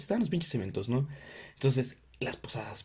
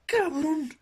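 high shocked squeal; mock shock; slower than usual. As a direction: squeal in fake outrage. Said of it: "Así de". (chillido de sorpresa fingida)

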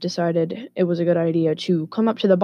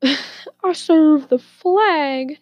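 very slow, high hammed-up boast; exaggerated patriotic pomp; mock patriot; 9 semitones higher, 4 dB louder, much slower than usual. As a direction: draw it out like a caricatured patriot.

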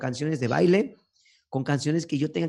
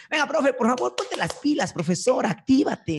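hype cheering; 10 semitones higher than usual. Acted. Energizing teacher at event.

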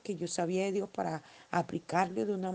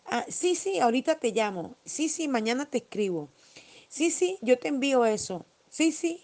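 mocking breezy high "sí, sí" brush-off; imitating someone who promises without meaning it; dismissive yes-yes; 5 semitones higher, 5 dB louder than usual. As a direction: toss off empty promises in a high sing-song.

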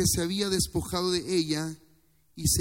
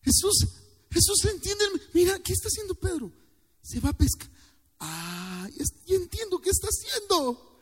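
much higher, excited pitch; emotional; 11 semitones higher than usual. Real, not acted. Preacher emotional voicing Jesus realizing, much higher. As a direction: voice Jesus catching on, elated. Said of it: "Perdón si me emociono".

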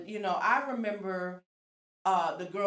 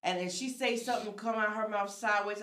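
ordinary delivery

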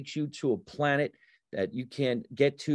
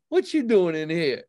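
higher-pitched incredulous challenge; mock confrontational stranger; big guy; 6 semitones higher, 4 dB louder than usual. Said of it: "some big guy".

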